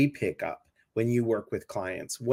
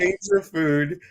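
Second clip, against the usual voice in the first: higher, slow mock Italian accent; 6 semitones higher, 3 dB louder, slower than usual. Host jokes the fish is Italian, mock Italian. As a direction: play it as a hammy Italian fish. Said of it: "he's a Italian fish".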